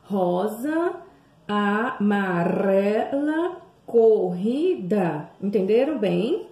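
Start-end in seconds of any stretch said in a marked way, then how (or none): 0.0-5.3 "Rosa, amarela, corrida" read very slowly, each word drawn out; slow model reading. Shown as a demonstration. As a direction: read the three words slowly for the class to repeat. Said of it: "Vamos ler de novo essas palavras". (leitura bem lenta e exagerada de "rosa, amarela, corrida")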